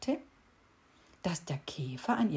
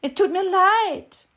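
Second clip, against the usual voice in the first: much higher, louder mocking mimicry; aping mockery; 13 semitones higher, 11 dB louder than usual. A narrator's reading. Beetle aping the cricket's apology, high and mocking. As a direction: parrot her back mockingly. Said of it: "äffte der Käfer sie nach".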